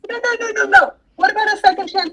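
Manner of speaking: put-on high-pitched whiny kid pleading; imitating a pleading kid. Stepmom mimicking Bryson begging for a second chance, high and whiny. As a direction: beg like a kid caught out.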